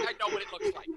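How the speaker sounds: high-pitched retold protest; mock exasperation. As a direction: protest it in amused embarrassment.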